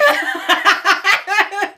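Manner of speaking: high, loud cackle; long fit of high giggles; helpless laughter. Real, not acted. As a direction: burst out laughing. Cracked up by the joke.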